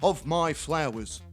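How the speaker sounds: low, dopey bloke voice for the neighbour; Terry's voice. Retold or imitated. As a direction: drop into a dull, deep-ish mate voice. Neighbour Terry quoted over fence.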